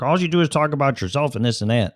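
lightly mimicked nagging accuser; mocking complaint. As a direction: toss off the nagging complaint quickly.

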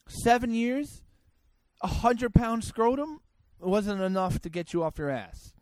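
ordinary talk

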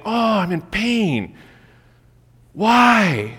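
high, drawn-out wail of complaint; hammed-up whine, swelling on the last word; mock self-pity. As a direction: moan theatrically over a scraped knee. Acts self-pitying sufferer with scraped knee.

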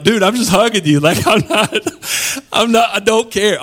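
high, laughing "I'm just hugging you"; playful exasperation through a laugh; laughing protest. Retold or imitated. Mock-defending a hug, laughing.

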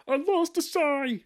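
hammed-up Brooklyn hothead line; Brooklyn accent. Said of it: "I'll do the line 'cause I know it".

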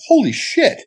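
high-pitched stunned exclamation; floored by the windfall, voice shooting up; amazed. Retold or imitated. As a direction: blurt it out in retold astonishment. Amazed at the money.

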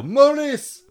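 high, loud mock yell. Host mimics morris dancers hitting sticks, high comic yell. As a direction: shout it like a morris dancer's cry. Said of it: "You hit the stick and you yell".

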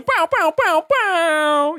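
pow sound effects. Pretend. Gun sound effect for attack.

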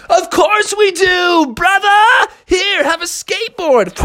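high-pitched hyped dude voice; surfer-dude turtle. Acted. Turtles greet the frog in the sewer, hyped. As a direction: hail him with a big cowabunga.

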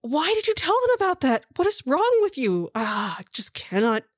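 high, exasperated acted rant; exasperated scolding. Woman storming back at her partner, acted high-pitched exasperation. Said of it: "The woman comes storming back out".

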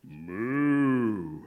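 long low moo; drawn-out low cow noise. A narrator's reading. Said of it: "Cow said".

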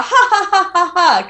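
laughing ha ha ha. Shown as a demonstration. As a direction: let each ha become a laugh. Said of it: "if you actually laugh with it".

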